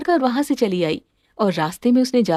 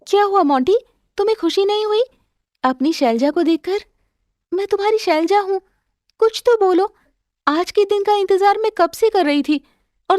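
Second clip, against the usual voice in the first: put-on higher, pleading young wife's voice; Shailja, eager and pleading; 7 semitones higher than usual. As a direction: coax him to speak, anxiously. (पति से बोलने की गुहार करती पत्नी, ऊँची आवाज़ में)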